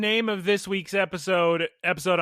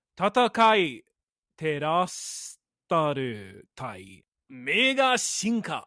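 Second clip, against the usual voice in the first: slow careful Japanese, syllable by syllable; slower; 2 semitones lower than usual. Shown as a demonstration. Second try at the Japanese title, slow and careful. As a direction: take it slowly so every syllable lands. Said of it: "I'm gonna say it slower".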